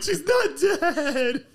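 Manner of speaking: slow, high, laughing through the words. Retold or imitated. Retelling the moment his mom walked out, laughing. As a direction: crack up while saying it. Kid realizing mom is alive.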